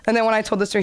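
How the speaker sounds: ordinary delivery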